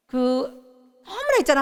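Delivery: normal talking voice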